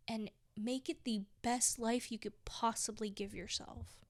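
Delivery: ordinary talk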